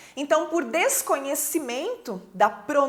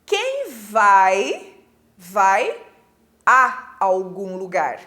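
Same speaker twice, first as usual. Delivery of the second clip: quem vai, vai a algum lugar with a dragged, stressed a; stress on the preposition a; 5 semitones lower, 3 dB louder, much slower than usual. Shown as a demonstration. Teacher stressing the preposition for students. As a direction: lean on the little word a. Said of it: "pense assim".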